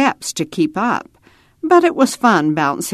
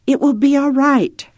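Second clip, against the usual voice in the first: put-on higher little-girl voice; reassuring child; 9 semitones higher, 3 dB louder than usual. A narrator's reading. Ada reassures Roy, high child's voice. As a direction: comfort a younger brother in a child's high voice. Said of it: "she said to Roy".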